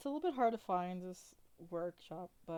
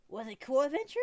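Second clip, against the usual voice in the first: put-on high, loud childlike question; high-pitched excited small child; little goblin girl; 6 semitones higher, 7 dB louder, faster than usual. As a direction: ask eagerly like a wide-eyed kid. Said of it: "she says".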